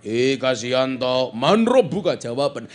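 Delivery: loud, drawn-out interrogation; questioning angel. Acted. Angel questions the village head in the grave, loud demand. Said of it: "takoni karo malaikat".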